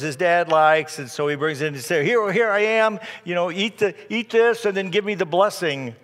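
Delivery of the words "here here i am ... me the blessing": eager arriving son, higher and louder; lightly acted excited offering. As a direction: burst in eagerly, pitched up. Voicing Esau arriving eagerly with food.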